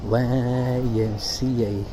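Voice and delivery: a slow, low echo of the name; drawn-out low echo. Shown as a demonstration. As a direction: let it ring out deep and slow. Repeating the building name.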